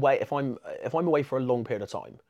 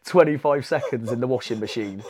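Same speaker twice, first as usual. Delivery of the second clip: higher and louder, laughing as he speaks; 4 semitones higher, 10 dB louder than usual. Real, not acted. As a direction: giggle through the punchline. Laughing at the Cyborg story.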